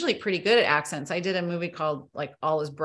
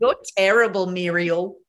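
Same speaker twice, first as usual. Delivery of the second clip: louder copied Australian film quote; Australian accent; 7 dB louder than usual. Shown as a demonstration. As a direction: echo the movie line in an Australian accent, with punch. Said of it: "that was very good".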